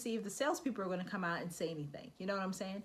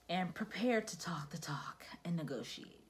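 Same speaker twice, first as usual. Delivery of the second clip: whisper; 3 dB quieter than usual. Real, not acted. Advice aside.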